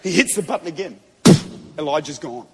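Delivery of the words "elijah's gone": ordinary delivery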